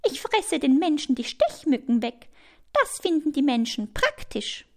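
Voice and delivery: high-voiced little spider; lightly voiced spider showing off; self-satisfied spider. A narrator's reading. Spider boasts of being useful.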